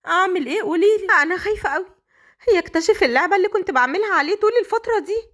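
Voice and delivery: high, fast, frightened pleading to mom; scared and panicked. A narrator's reading. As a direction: beg your mother for help, near tears. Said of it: "سهام كانت خايفة ومرتبكة جدًا". (زوجة خايفة بتستنجد بمامتها بصوت عالي ومرتبك)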